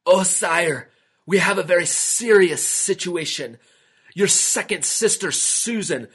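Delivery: a messenger bringing bad news in distress. A narrator's reading. Messenger reports to the king, distressed voice. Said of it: "in a very distressed voice".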